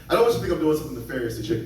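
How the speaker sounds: very fast rush through the line; comic rushing the line as a retry. Shown as a demonstration. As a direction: gabble it out fast. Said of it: "This is never gonna be high enough".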